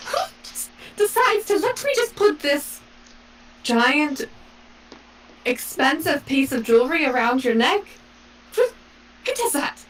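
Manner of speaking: high, incredulous mock outrage; exasperated scoff. Noblewoman mocks the lord's gift, incredulous high scoff.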